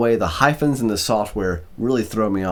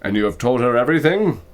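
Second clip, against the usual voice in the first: raised, louder question; questioning father; 4 semitones higher, 5 dB louder than usual. A narrator's reading. Cat father asks his son, probing question. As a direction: press the question on your son.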